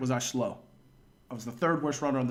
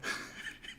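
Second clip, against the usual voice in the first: breathless laugh; 15 dB quieter than usual. Real, not acted. Runner passed out on ground.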